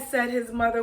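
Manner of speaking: ordinary delivery, nothing marked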